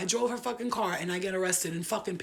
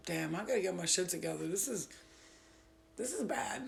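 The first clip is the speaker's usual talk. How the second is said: sobering self-talk; 7 dB quieter than usual. Retold or imitated. Woman alone on a bench facing her life, quiet self-talk. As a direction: say it softly to yourself.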